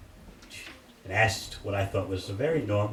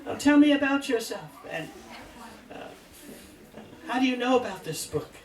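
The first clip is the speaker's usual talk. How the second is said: much higher, sweet woman's voice; chatty lady devotee; 12 semitones higher than usual. Retold or imitated. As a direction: sweetly dodge the question as the lady. Lady deflecting, voiced by teller.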